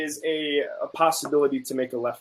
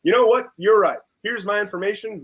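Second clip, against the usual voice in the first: bright, louder, higher mock give-in; mock concession; 7 semitones higher, 3 dB louder than usual. To a friend, mocking the idea that one driver gives in: sarcastic, higher and louder. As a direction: play the guy who just caves in, sarcastically. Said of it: "gonna be like".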